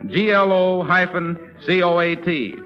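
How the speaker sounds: ordinary delivery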